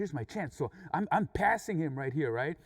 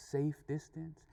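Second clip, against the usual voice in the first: ordinary delivery, nothing marked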